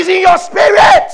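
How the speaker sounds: high, loud preaching shout; full-force pulpit shout. Real, not acted. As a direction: blast it to the congregation.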